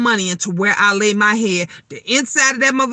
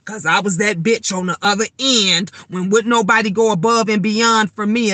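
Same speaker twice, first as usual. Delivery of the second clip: usual speaking voice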